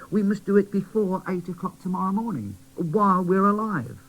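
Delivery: put-on high, bright child voice; boy doll urging the toys to play, high; cheerful little boy doll. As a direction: cheer them up. Quincy speaks.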